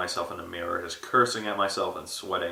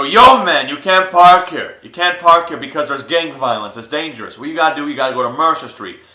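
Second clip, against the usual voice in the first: loud, raised-pitch hustler's advice; street-wise homeless man; 5 semitones higher, 12 dB louder than usual. Retold or imitated. Homeless man coaching the new guy, loud and insistent. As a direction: show a newcomer the ropes, loud and insistent.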